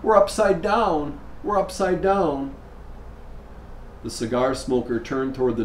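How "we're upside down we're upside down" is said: higher, slow, mock frightened little girl; lightly voiced scared child repeating herself; frightened sobbing. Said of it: "sob and repeat in a frightened voice".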